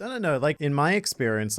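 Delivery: ordinary talk, nothing marked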